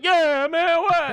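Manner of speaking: high, loud rowdy agreement; retold crowd shout. Prom kids all joining in, imitated rowdy shouting. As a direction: holler like a riled-up crowd.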